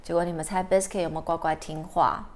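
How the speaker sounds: usual speaking voice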